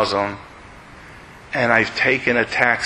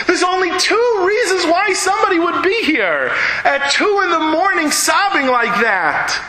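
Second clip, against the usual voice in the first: pained, tearful. Real, not acted. Preacher grieving aloud over the sobbing man, tearful. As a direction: let it break, as if near tears.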